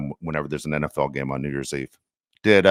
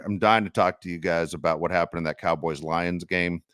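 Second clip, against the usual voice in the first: normal talking voice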